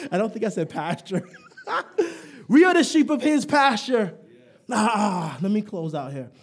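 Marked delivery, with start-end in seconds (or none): none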